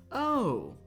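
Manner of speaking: high, loud exclamation; mock surprise. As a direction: greet with a big showy "oh". Acted greeting of the princess.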